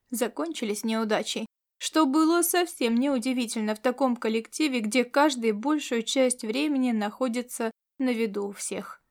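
plain reading voice

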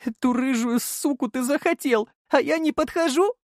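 very high, outraged woman's voice; scorned fiancée's high-pitched protest; jealous tearful outburst. A narrator's reading. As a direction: throw the accusation at him in a high wail.

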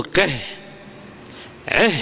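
"قه، عه" short, each ending in h; ha of silence. Shown as a demonstration. Teacher showing one-letter verbs paused, final h. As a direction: add a breathed h to "قه، عه". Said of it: "وأردت أن تقف عليه".

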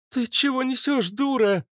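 very high-pitched indignant outburst; outraged retort. A narrator's reading. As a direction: snap back high and indignant. Saleswoman protests in outrage. (возмущённо, очень высоким голосом)

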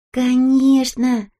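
emphatic agreement. A narrator's reading. Kisonka backs her friend up, emphatic agreement. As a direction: stretch the word in hearty assent.

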